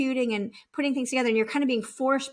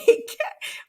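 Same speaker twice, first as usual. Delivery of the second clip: laugh; 11 semitones higher than usual. Real, not acted. Laughs at own act.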